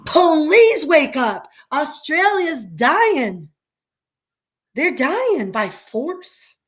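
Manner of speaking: slow, high pleading plea; pleading quote. Retold or imitated. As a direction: beg them slowly to wake up. Quoting a pleading video voice.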